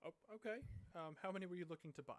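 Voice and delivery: surprised lady asks how many berries; lady's voice. Acted. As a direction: lift the voice for the shop lady. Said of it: "she looks at you and goes".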